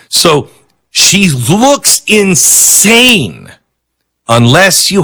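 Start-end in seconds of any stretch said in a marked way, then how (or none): 0.9-3.5 slow drawn-out. Shown as a demonstration. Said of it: "repeated from line 9".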